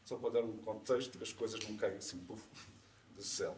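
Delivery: ordinary delivery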